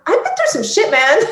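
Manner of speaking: high-pitched, amazed exclamation; sudden jump up in pitch, incredulous; exclaimed realization. Retold or imitated. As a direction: blurt it out in disbelief at yourself. Retelling her breakthrough realization in therapy.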